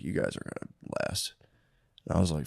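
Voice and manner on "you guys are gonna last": breathy whisper of a wise mentor; imitating a friend's quiet warning; whispered retold quote. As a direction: hush it like a secret warning.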